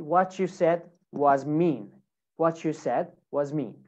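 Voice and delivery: "what you said was mean" starting "whatchoo"; "what you" joined with CH; linked CH sound. Shown as a demonstration. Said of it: "So you can say".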